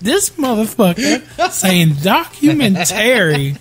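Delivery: high, slow, drawn-out disbelief; slow high-pitched mock outrage; exasperated rant. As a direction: rant slowly at the offending word. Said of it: "I was like".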